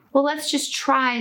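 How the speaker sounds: squirrel voice. Acted. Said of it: "said the squirrel".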